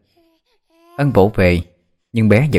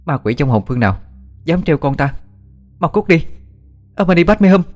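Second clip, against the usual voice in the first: furious shout; 8 semitones higher than usual. Acted. Young mother yelling at the ghost near her baby, furious shout. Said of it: "Cô quát lớn".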